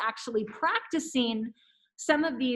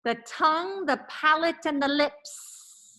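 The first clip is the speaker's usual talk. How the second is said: slow, over-enunciated diction drill; careful articulation; 3 semitones higher than usual. Shown as a demonstration. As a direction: enunciate every syllable clearly. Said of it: "So repeat after me".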